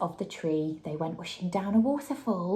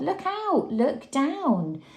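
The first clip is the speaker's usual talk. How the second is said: yelled; 8 semitones higher, much slower than usual. A narrator's reading. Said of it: "who yelled".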